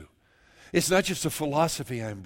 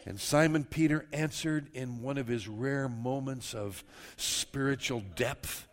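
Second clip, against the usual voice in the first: ordinary delivery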